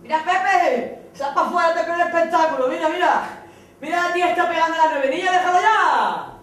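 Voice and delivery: very high, loud, mocking village cop; gossiping policeman. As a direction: call out to a colleague, scandalised. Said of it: "Y sale Manolo".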